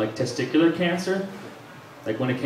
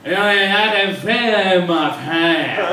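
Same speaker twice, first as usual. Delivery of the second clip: "I had a vein in my pants" slurred slowly, as if through a numbed Novocaine mouth; 3 semitones higher, 4 dB louder, much slower than usual. Shown as a demonstration. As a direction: mumble it slowly, mouth numb from the shot. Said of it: "I had Novocaine in my mouth".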